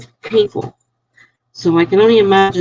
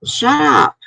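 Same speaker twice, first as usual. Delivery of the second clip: higher, mock scolding nurse; imitating the impatient nurse, pitched up; mock nurse yelling; 10 semitones higher than usual. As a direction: snap at the patient like the nurse did. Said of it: "And she's like".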